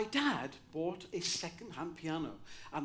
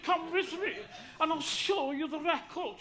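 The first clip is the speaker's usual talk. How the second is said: higher lilting Welsh curator; 9 semitones higher than usual. Retold or imitated. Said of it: "they all speak like this".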